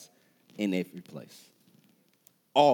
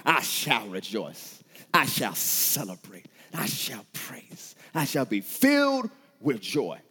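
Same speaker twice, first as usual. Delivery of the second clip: slow, deliberate vow; drawn-out, measured proclamation; resolute declaration; slower than usual. Shown as a demonstration. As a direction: declare it word by word with weight. Models answer to what you'll do.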